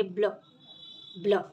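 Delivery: ब्ल said as one quick blended sound. Shown as a demonstration. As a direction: join ब and ल into ब्ल. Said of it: "ब और ला को ब्लेंड करके बोलेंगे". (ब और ल को मिलाकर ब्ल बोलिए)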